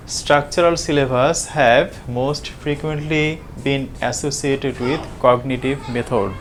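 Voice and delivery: reads the quoted syllabus line slowly, word by word; slow reading aloud. Acted. Lecturer reading a syllabus point to students, very slowly. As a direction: dictate it slowly so students can follow.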